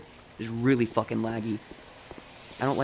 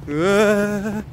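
frantic repeated pleas, getting louder; panicked; 5 semitones higher, 5 dB louder than usual. Real, not acted. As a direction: panic building to a loud scared cry. Chased by monster, panicking.

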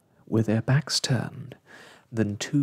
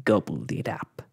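chuckling; 5 semitones lower than usual. A narrator's reading. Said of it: "chuckled the other".

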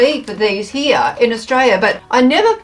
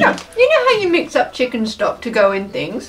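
crunching snack between words; talking while chewing; 2 semitones lower than usual. Real, not acted. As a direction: mouth partly full. Seen 2: Woman raises crisp to mouth at +0.4, keeps nibbling while talking.

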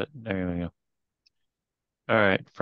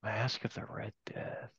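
breathy whisper to self; barely voiced, slow murmur; 2 semitones lower, 15 dB quieter than usual. Real, not acted. Quietly recalling title to self.

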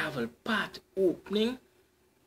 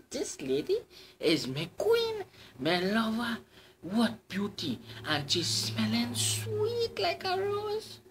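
smitten cyclist; 7 semitones higher than usual. Acted. Cyclist praising the lady.

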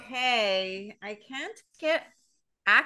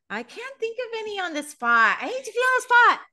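much higher, squeaky protest; put-on-the-spot squeal; flustered protest; 12 semitones higher, 5 dB louder than usual. Real, not acted. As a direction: protest in a high, pressured voice.

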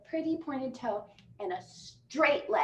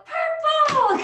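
high excited shout; yelling; 14 semitones higher, 12 dB louder than usual. Retold or imitated. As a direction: shout the color out. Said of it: "We yelled".

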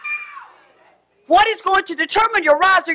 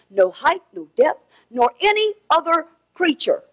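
scripture reading; 5 semitones lower than usual. Acted. Reads scripture, fading quiet.

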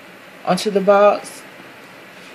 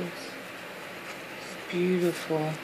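very slow, soft, drawn-out beautiful; awed murmur; 13 dB quieter, much slower than usual. Real, not acted. Admiring the gift's pink ribbon, slow hushed awe. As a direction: let the word melt out in wonder. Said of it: "I am so in awe of this box".

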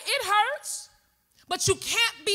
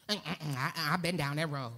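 much lower, soft brush-off; dismissive murmur; 10 dB quieter than usual. Retold or imitated. Guarded person turning away someone offering love, low and soft. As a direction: mutter a guarded refusal, low.

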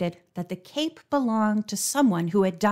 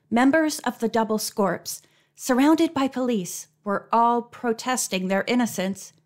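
ordinary delivery

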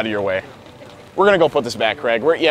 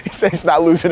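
slow, higher, louder laughing lead-in; 9 semitones higher, 5 dB louder, slower than usual. Real, not acted. Prankster amused by worker's refusal, laughing. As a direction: laugh your way into the words.

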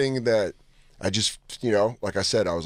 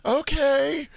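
high surprised okay; a high drawn-out okay; mock surprise. Mom caught off guard, high surprised okay. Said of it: "And she was like".

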